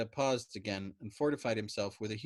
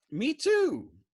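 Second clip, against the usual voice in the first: much higher, loud delighted cry; rejoicing; 10 dB louder than usual. A narrator's reading. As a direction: burst out with joy. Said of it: "A Fortiori rejoiced".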